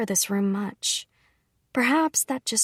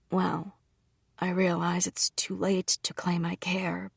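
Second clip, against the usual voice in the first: softer, whispered admission; awkward whisper; 5 dB quieter than usual. Acted. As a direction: mumble it, embarrassed to care. Brother's awkward confession.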